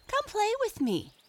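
very high playful shout; Peter Rabbit. A narrator's reading. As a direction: call out high to invite friends. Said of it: "shouted Peter Rabbit".

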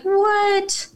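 high, drawn-out "what"; stretched incredulous squeak; baffled reaction. Retold or imitated. Acts out baffled reaction to story.

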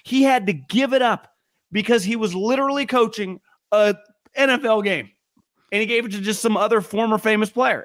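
mock outrage, half laughing; sarcastic. Real, not acted. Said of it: "We're being sarcastic".

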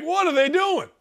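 indignant fan outcry. Retold or imitated. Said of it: "We're like".

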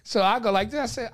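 normal talking voice

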